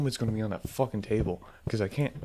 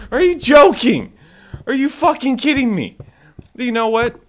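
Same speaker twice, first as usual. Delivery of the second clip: high, loud exasperated outburst; outraged yelling; 11 semitones higher, 10 dB louder than usual. Real, not acted. Player fed up with jump scares, outraged yelling. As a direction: yell in disbelief at the game.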